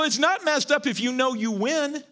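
ordinary talk, nothing marked

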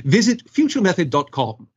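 British-accented commercial read; English accent. Shown as a demonstration. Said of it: "Just give us your best accent".

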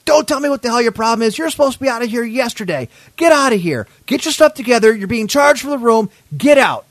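imitated high-pitched angry scolding; reading the riot act. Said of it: "just reading me the riot act".

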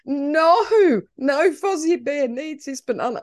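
high, wailing mock sob; mock crying. Owner pretending to cry over the lost Lego banana, mock sobbing. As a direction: whimper like a child losing a toy. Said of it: "I'm starting to cry".

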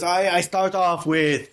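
high, slow Spanish-accented imitation; Jodorowsky's Spanish accent. Podcaster quoting a film director, in a put-on Spanish accent. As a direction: imitate an excitable Spanish-accented speaker, drawn out. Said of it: "we're gonna do the accent".

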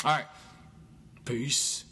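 ordinary talk, nothing marked

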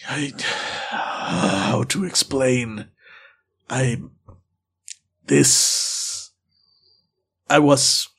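slow broken phrases, long gaps between; halting search for words. Acted. Wild man struggling to explain himself, halting and slow. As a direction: grope for each word like language is new. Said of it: "taking him real effort to find the right words".

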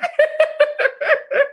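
a long, very high giggle; high burst of laughter. Real, not acted. Amused at how cocky she got, high laughter. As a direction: laugh at your own boldness. Laughing at her own cockiness.

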